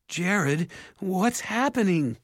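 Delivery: high, alarmed call. A narrator's reading. Mother calling to her son, alarmed. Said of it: "their mother called".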